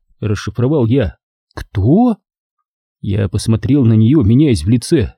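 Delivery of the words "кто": surprised woman's voice. Acted. Leonora puzzled.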